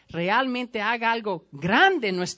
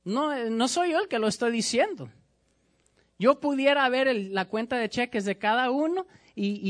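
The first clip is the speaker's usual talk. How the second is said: ordinary talk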